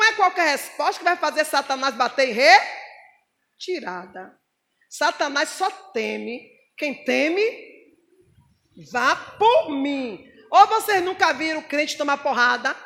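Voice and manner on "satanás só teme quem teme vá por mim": each word slowed right down; slow emphasis. Real, not acted. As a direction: lay it down slowly and firmly. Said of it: "ênfase lenta no sermão". (bem devagar, com peso)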